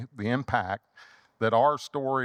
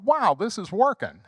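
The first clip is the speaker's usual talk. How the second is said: pleased surprise; 10 semitones higher, 4 dB louder than usual. Retold or imitated. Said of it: "And I thought".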